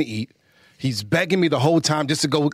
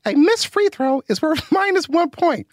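son's voice; 12 semitones higher than usual. Pretend. Dad reading the question in his son's high voice. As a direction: read the question like a toddler. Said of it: "my son is so bad".

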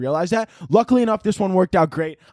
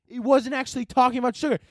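normal talking voice

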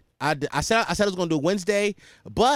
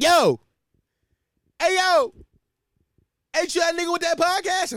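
loud, high excited callout across the boardwalk; hyped-up stranger; 3 dB louder than usual. Retold or imitated. Stranger on the boardwalk calls out, high and loud. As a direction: shout it like an excited fan spotting you. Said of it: "they're like".

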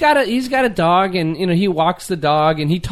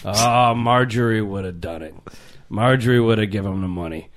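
low, soft longing sigh; a lightly voiced old man missing his wife; a hint of a mournful widower; wistful widower; 8 semitones lower, 3 dB quieter than usual. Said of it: "he thinks about his deceased wife".

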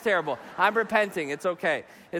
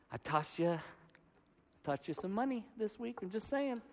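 very soft, coy hint to God; hushed bargaining; 13 dB quieter than usual. Acted. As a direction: drop a quiet reminder of your gift.

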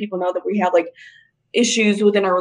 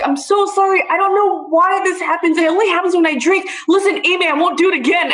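higher-pitched imitation of an apologizing boyfriend; mock pleading apology; 6 semitones higher than usual. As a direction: plead in a raised mock voice, hurrying along. Boyfriend's frantic apology, retold.

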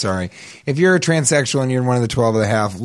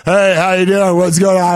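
a bit louder imitating a man's casual greeting; mock man's voice, a touch loud; guy's voice; 4 semitones higher, 4 dB louder than usual. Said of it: "just in a regular guy's voice".

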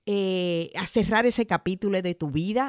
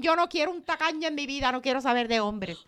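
mock complaining woman; 6 semitones higher, faster than usual. Voicing a stingy-hating woman.

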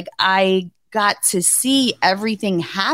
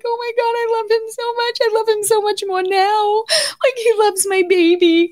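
much higher voice cracking with tears; tearful high gush; 13 semitones higher than usual. Real, not acted. Wife recalling husband singing to baby, tearful and high. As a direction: gush through tears of love.

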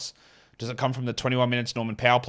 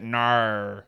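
a broad Australian "no."; the answer in a thick Australian accent; 2 semitones lower than usual. Shown as a demonstration. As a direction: say "no." the Aussie way, with a long open vowel. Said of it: "Or in my Australian accent".

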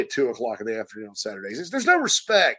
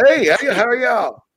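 higher, louder coach greeting; 9 semitones higher, 5 dB louder than usual. Retold or imitated. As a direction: greet the caller like the old coach. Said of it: "Coach Beamer".

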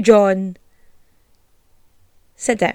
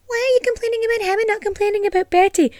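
quick, higher put-on voice of a nitpicker; imagined objecting listener; 9 semitones higher, much faster than usual. Host voicing a listener who defends John, fast and higher. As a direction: rattle off the objection in someone else's voice.

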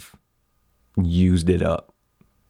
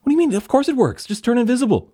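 much higher, incredulous and impatient; exasperated character voice; faster than usual. Acted. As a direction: scold him like he's an idiot.

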